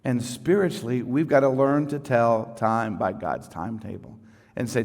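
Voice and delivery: usual speaking voice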